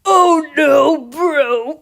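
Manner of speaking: throat-straining attempt at a cartoon character; Muscle Man. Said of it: "doing the Muscle Man voice right".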